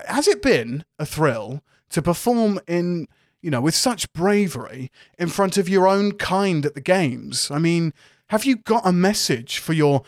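a fumbling posh journalist; posh reporter. Acted. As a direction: GM voices a hesitant posh reporter. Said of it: "the kind of more posh reporter".

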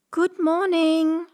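high, slow sing-out greeting; drawn-out girlish greeting; little girl calling out. A narrator's reading. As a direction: call it out high, stretching the words. Girl calls into the house.

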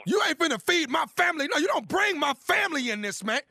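fake angry shout. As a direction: yell back like the insult is real. Prank caller fakes a furious row.

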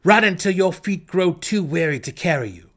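high and loud drow command. A narrator's reading. Drow ordering the goblins to run, loud command. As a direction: drive them off with your voice. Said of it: "Driz commanded".

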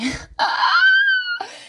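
very high squeal of laughter; piercing cackle; real shrieking laugh. Real, not acted. Laughs at her own story.

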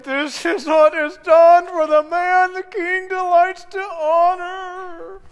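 high, slow strained proclamation; through gritted teeth. Acted. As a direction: force it out through clenched teeth. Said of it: "I said that through gritted teeth".

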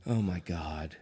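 low, soft, disappointed sigh of words; a father's let-down groan; disappointed dad. Retold or imitated. Dad on the phone hearing about drinking game, low disappointed tone. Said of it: "I hear his disappointment".

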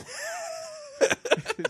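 high laughing burst; high burst of laughter. Real, not acted. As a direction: break out laughing. Nervous laugh.

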